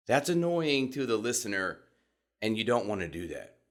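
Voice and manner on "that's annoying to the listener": ordinary talk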